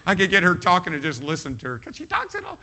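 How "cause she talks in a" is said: put-on very high, squeaky little girl voice; high cartoon child imitation. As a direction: mimic a tiny animated cartoon girl. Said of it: "She's got the little cartoon voice".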